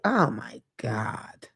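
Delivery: slow, whispered exasperation; exasperated whisper. Retold or imitated. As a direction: sigh it out in a whisper, fed up. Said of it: "But now I'm just like".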